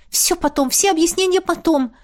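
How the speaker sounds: plain reading voice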